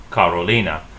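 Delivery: "Carolina" said kah-roh-LEE-nah; Spanish-style "Carolina", weight on "lee"; Spanish pronunciation. Shown as a demonstration. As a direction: say "Carolina" with ah, oh and a stressed ee. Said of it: "it's pronounced Carolina".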